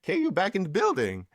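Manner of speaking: high excited hype. Retold or imitated. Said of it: "I'm like".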